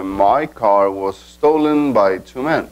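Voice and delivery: slow, deliberate English example; slow model sentence. Shown as a demonstration. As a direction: spell it out slowly for the class. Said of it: "les va a decir".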